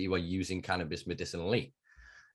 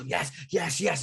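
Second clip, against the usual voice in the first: high, excited mock cheers; eager cheering; 9 semitones higher, slower than usual. Mimicking runners who think they're winning the race, eager cheering. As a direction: cheer yourself on, pitched well up.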